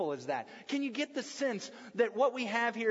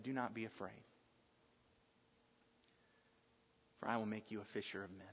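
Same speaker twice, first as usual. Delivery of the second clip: softer, hushed reassuring words; gentle reassurance; 11 semitones lower, 12 dB quieter, faster than usual. Acted. Jesus calming the fearful Peter, soft and reassuring. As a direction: speak low and calm to a frightened man.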